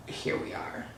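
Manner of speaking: voiceless whisper to oneself; stunned, breath-only realisation; hushed awe. Retold or imitated. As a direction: whisper it, barely audible. Awed on seeing rep room.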